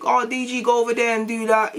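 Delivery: lightly put-on high managerial voice; imitated company boss. Retold company orders to him.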